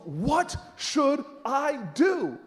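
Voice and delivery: slow, word-by-word question; strained patience. Retold or imitated. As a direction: spell it out with barely held patience.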